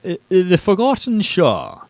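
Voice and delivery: a light put-on English accent on "The Forgotten Shore"; host trying a playful British accent; mock English accent. Host tries a light English accent on the book title. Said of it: "maybe I'll try an English accent".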